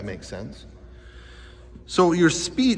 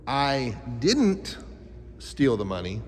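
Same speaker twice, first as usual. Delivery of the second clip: "didn't" punched and held, pause, then "steal the money" lower; stress on DIDN'T; 3 semitones lower, 3 dB quieter, slower than usual. Shown as a demonstration. Teacher showing kids voice inflection, stress on DIDN'T. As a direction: hit the word "didn't" hard, then break. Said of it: "Voice inflection is like this".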